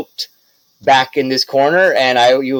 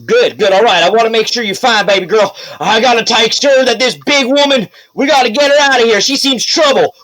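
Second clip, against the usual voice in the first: eager mayor checking in; 7 semitones higher than usual. Acted. Mayor checking on Wendlyn, higher and eager. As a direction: fuss over her like a worried suitor.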